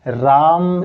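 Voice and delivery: "राम" prolonged on the आ; drawn-out call. Shown as a demonstration. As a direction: hold "राम" long, without raising the voice. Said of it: "इस तरीके से".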